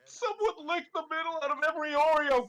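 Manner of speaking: loud, high wailing complaint; whiny. Acted. Luigi complaining over his pile of Oreos, whiny voice. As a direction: wail it like a hammed-up sulk. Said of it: "in a whiny voice".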